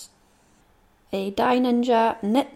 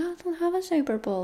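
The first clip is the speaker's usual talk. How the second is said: mock dismay; 8 semitones higher, 3 dB quieter, faster than usual. Inner thought voiced as quote.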